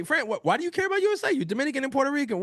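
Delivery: high, quick mocking question; mock critic voice. To his co-host, mimicking critics who question his loyalty, mock voice. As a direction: lightly play a doubter needling him. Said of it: "people might say".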